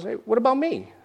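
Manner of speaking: high, mock-hurt puppet whine; left-out Gonzo. Gonzo feeling forgotten, in a high mock-hurt whine. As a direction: complain that nobody thanked him. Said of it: "he goes".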